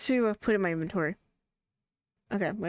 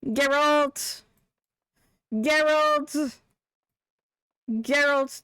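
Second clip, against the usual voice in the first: higher, raised name call; 6 semitones higher, 5 dB louder, much slower than usual. Pretend. Streamer trying to hand Geralt an item, calling out. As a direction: holler insistently for attention. Repeatedly calling a game character.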